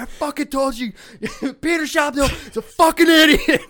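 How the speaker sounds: high, loud teacher rant, breaking into laughter; imitated furious shout. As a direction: rant like the teacher while cracking up. Voicing the irritated teacher.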